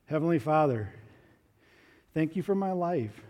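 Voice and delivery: simple reverent prayer. Acted. Leads children in prayer.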